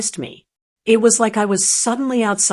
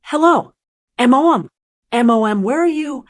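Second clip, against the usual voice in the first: terrified scream; 3 semitones higher, 4 dB louder than usual. Retold or imitated. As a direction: scream it, scared and out of breath. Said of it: "He was screaming".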